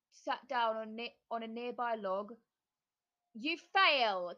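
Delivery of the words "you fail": loud cackling witch. A narrator's reading. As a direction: cackle the verdict. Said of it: "cackled the witch".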